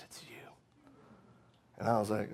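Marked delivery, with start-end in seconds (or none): 0.0-0.5 whispered, barely voiced. Chaplain tells the preacher who answered his prayers, whispered. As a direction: breathe it out as a hushed secret.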